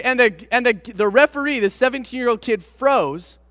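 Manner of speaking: normal talking voice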